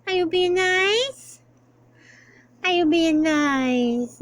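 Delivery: very high calling sing-song; pet baby talk. Acted. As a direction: call the cat sweetly, slow.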